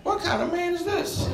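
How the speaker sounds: high-pitched, softened incredulity; appalled disbelief. Acted. Wife appalled at her pouting husband, high incredulous voice. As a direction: squeak it out in mock dismay.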